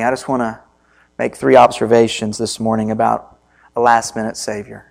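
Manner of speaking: usual speaking voice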